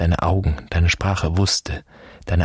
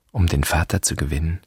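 ordinary talk, nothing marked

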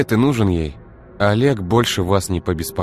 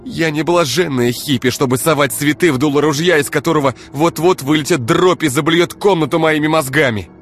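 high, loud, screaming tirade; enraged woman screaming her grievances at her boyfriend; furious rant; 12 semitones higher, 6 dB louder than usual. Acted. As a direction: hurl every grievance at him. Ira furious rant at Igor.

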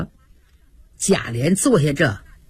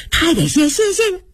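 higher, eager thanks; gushing thanks; 8 semitones higher, faster than usual. A narrator's reading. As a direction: gush thanks again and again. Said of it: "不住口地说".

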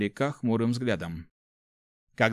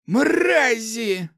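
high, forced curse through gritted teeth; furious hiss; 12 semitones higher, 6 dB louder than usual. A narrator's reading. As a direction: spit the insult out in rage. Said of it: "прошипел Макс, скрипя зубами". (злобно, сквозь зубы)

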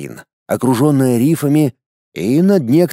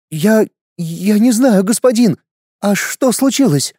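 stammering mother; 7 semitones higher than usual. Acted. Mother stammers before warrior.

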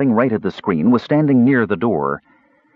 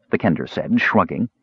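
usual speaking voice

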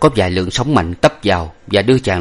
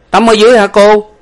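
high, loud, naive questioning; puzzled servant Lục Tặc; 13 semitones higher, 9 dB louder than usual. A narrator's reading. Servant asking the young lady about swimming, puzzled. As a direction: call it out high, baffled.

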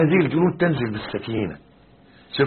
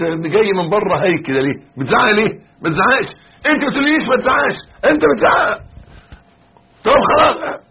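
husband-and-wife row climbing to a high yell; acted shouting match; 9 semitones higher, 5 dB louder than usual. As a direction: act out a quarrel, escalating louder and higher. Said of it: "وتزعق أنت كمان. هي تقول لك".

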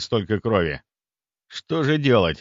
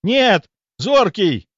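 high, loud cry of horror; крик; 10 semitones higher, 6 dB louder, much slower than usual. A narrator's reading. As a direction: yell out for a friend in danger. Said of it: "заорал я". (отчаянный крик)

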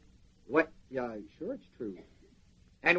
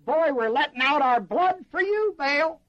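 fervent worshippers; 6 semitones higher than usual. Retold or imitated. Prophets cutting themselves for Baal.

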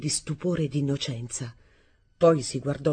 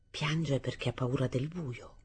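much softer, muttered to himself with bitter pleasure; harsh muttered satisfaction; 2 semitones lower, 8 dB quieter than usual. Acted. Arturo alone behind the door, soft bitter mutter. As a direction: say it under your breath, pleased and cruel. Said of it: "mi dissi con aspra soddisfazione".

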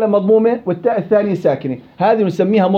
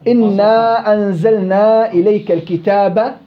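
slow melodic recitation of the verse; Quran recitation; slower than usual. Shown as a demonstration. Teacher reciting a verse slowly for students. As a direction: recite it in the tajweed chant for the class. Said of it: "teacher recites Quran verse".

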